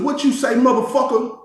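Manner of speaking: high-pitched, confronting retort; retold challenge. Man confronting another inmate over a slur, retold challenge.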